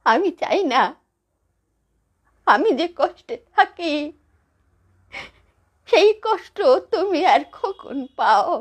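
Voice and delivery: high, anguished plea with long pauses; tearful pleading. Acted. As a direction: plead through tears, haltingly.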